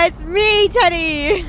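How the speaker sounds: wailing the name like a sob; hammed-up cry from the trunk; mock crying shout. Trapped in the trunk, calling out with a mock sob.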